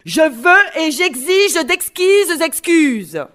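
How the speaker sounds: loud, slow, hammered angry demand. Shown as a demonstration. Teacher shows children the sentence in anger, loud angry demand. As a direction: demand it in mock anger, stretching each word. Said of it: "je peux le faire sur le ton, euh, de la colère aussi".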